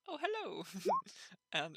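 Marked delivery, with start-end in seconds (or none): 0.1-0.6 character voice. Acted. Character greets from mound.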